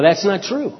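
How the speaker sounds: usual speaking voice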